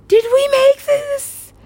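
very high, slow squeal of wonder; acted tearful-sounding awe; awed questioning. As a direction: stretch it out in high amazement. Quoting awed question to God.

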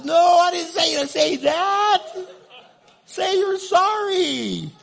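very high, drawn-out comic bellow; exasperated shouted command. Acted. God correcting the husband mid-apology, high exasperated shout. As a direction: shout it slowly at the top of the voice.

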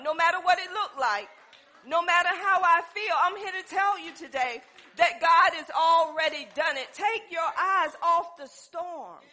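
loud, rising preacher's cry; preaching at full force. Acted. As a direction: preach it out to the church.

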